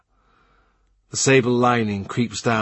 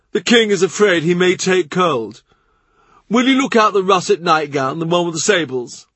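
higher, louder call over the shoulder; Norris instructing; 8 semitones higher, 5 dB louder than usual. Acted. Norris calls over his shoulder to Brereton, higher and louder. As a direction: toss the order back.

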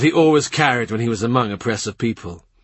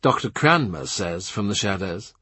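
ordinary delivery, nothing marked

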